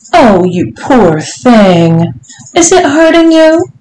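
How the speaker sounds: syrupy false sympathy, swooping high; mocking. A narrator's reading. Lady to her visitor, mocking with false concern. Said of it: "Missus Johnson mocked".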